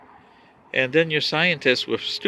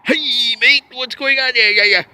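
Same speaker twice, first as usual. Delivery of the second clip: put-on higher, louder Australian accent; hammed-up Australian greeting; loud, jolly Australian accent; Aussie accent; 9 semitones higher, 6 dB louder than usual. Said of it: "can you imagine an Aussie out there?".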